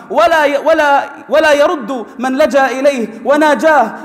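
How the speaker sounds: impassioned Arabic sermon climax; rousing preaching. Acted. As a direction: build the fire of a sermon up to a cry. Preacher building to full force.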